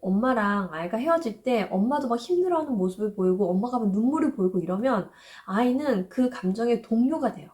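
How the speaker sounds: normal talking voice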